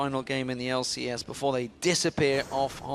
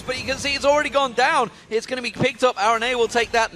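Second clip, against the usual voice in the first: urgent live match call, climbing higher; excited play-by-play; 6 semitones higher, 4 dB louder, faster than usual. Real, not acted. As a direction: build the tension as the base falls.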